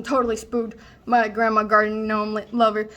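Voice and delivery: ordinary delivery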